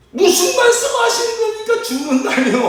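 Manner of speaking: very high, outraged mock protest; indignant Peter. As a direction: fire back in high-pitched disbelief. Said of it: "베드로가 화가 났는지 대들었다는". (화나서 대드는 높은 목소리)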